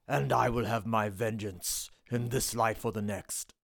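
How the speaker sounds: low, grave vengeful general; Maximus impression. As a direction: deliver it like a wronged Roman general. Gladiator speech impression.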